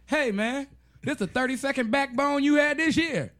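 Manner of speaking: a high, ribbing aside to a friend; mock incredulous thought. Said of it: "And I'm thinking".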